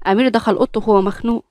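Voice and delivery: plain reading voice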